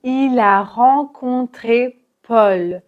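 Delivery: Il a rencontré Paul slowly, linked, closed O in Paul; slow careful model; learner's model sentence; liaison and closed O. Shown as a demonstration. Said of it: "Paul avec un O fermé".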